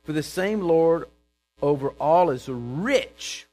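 preaching. Real, not acted. Preacher reads scripture to the congregation, forceful proclamation. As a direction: proclaim the verse, slowing toward the end.

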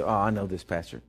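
a smug listener brushing it off; mock churchgoer. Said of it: "You say".

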